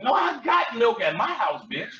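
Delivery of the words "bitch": ordinary talk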